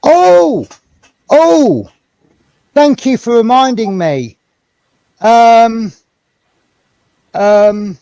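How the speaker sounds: loud high burst of excitement; excited. Real, not acted. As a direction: blurt it out, suddenly excited. Sudden excited remembering.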